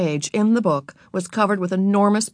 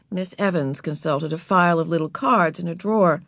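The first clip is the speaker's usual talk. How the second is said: plain reading voice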